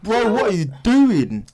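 slow, high, loud mock dismay; acting out an appalled reaction; exasperated disbelief. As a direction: stretch it out in disbelief. Acts out reaction to bad accent.

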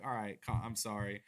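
mumbled mock apology; meek apology. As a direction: shrink back and apologize. Backing down meekly.

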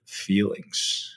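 "feelings" breathed out softly like a forbidden word; naughty F word. Shown as a demonstration. Coach jokingly says "feelings" as the dirty F word, hushed. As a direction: say "feelings" as if it were a swear, hushed. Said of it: "I'm gonna say the F word".

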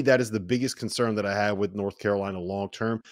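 ordinary talk, nothing marked

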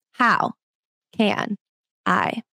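"How", "can", "I?" each set apart by pauses and falling away; slow, separated "How can I?" fading at the end; word by word. Shown as a demonstration. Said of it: "different ways that you can actually emphasize".

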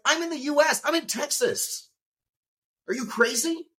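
high, disbelieving voice; incredulous outrage. Retold or imitated. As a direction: squeak out disbelief at being held. Incredulous retold outburst at hospital.

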